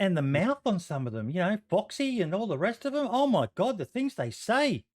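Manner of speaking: mock-scandalized, high swooping pitch; sarcastic outrage. Co-host pretending shock at players' dirty mouths, mock-scandalized. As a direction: act appalled, drawing out the last words.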